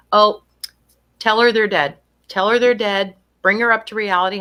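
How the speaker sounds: loud, firm insistence to face facts; imitating blunt relatives, pushing hard; mock insistent people. Imitating relatives demanding the truth be told, loud. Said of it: "I still hear people say".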